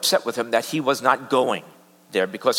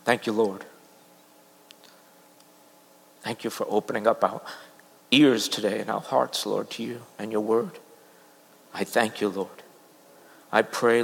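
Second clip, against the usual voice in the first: prayer; 3 semitones lower than usual. Acted. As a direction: pray it gently to the Lord. Closing prayer.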